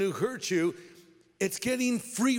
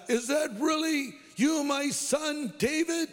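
much higher voice, mild shout; calling back; 10 semitones higher than usual. Retold or imitated. Saul answers David across the distance, calling back. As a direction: call back in a raised, drawn-out voice. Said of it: "Saul called back".

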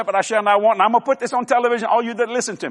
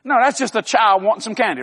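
ordinary talk

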